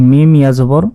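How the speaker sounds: ordinary delivery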